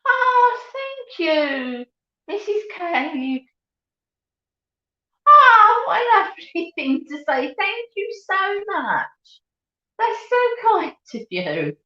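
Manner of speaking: high, delighted thanks; touched gushing. Real, not acted. Mum thanking a viewer for a lovely compliment, gushing. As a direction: melt at the compliment.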